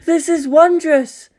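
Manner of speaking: high, loud, slow awestruck cry; drawn-out gleeful exclamation; wondrous delight. Acted. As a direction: marvel out loud like a child. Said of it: "jumping up and down in glee".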